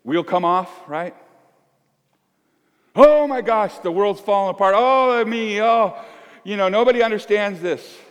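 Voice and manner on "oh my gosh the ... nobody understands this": higher, louder hammed-up panic; distressed person crying that nobody understands, mock panic; mock despair. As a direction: wail that everything is falling apart. Person whose world falls apart.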